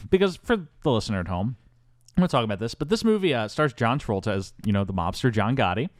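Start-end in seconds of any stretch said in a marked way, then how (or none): none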